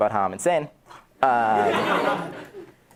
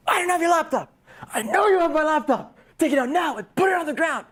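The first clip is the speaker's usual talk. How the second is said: shouting demand. Retold or imitated. Confronting the laptop thief.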